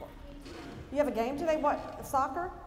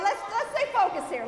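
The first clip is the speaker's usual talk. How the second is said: laughing; 5 semitones higher, 4 dB louder than usual. Real, not acted. Laughing at the chaos.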